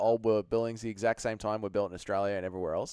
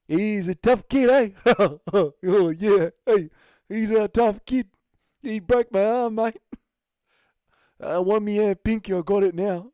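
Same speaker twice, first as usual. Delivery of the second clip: put-on loud, higher New Zealand accent with laughs; Dan Hooker accent; 8 semitones higher, 9 dB louder than usual. Streamer playing a Kiwi fighter for chat, loud New Zealand accent. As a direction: boom it out like a cheerful Kiwi brawler. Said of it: "Can you do a Dan Hooker New Zealand accent?".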